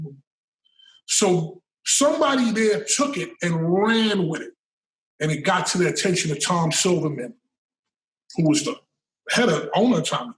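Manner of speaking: normal talking voice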